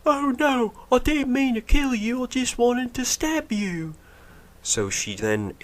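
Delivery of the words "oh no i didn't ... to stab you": mock Rey. Mocking Rey after she blows up the transport, squeaky high. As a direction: squeal it like a clueless heroine. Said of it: "And she's like".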